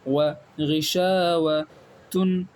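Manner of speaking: غشاوتن drawn out very slowly as ghi-sha-wa-tun; slow model reading. Shown as a demonstration. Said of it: "اسی طریقے سے".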